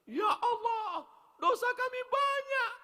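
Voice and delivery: mock tearful prayer. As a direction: ham up a weepy zikir. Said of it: "saya bilang". (meniru pemimpin zikir meratap)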